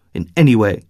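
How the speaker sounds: ordinary delivery, nothing marked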